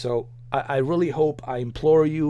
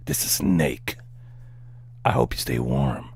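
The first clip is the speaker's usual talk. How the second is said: low, whispery gravel-voiced soldier; Snake voice; 5 semitones lower than usual. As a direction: growl it low like a stealth soldier. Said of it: "You want me to do it in Snake voice?".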